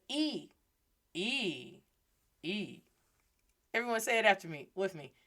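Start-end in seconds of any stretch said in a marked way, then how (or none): 0.1-2.8 E said as a pure ee sound, fading quieter; gentle demo of E; soft vowel drill. Shown as a demonstration. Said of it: "he says".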